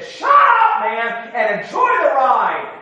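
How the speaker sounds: loud exasperated outburst; shouting. Retold or imitated. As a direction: tell the rude man off at full volume. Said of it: "And out loud, he said".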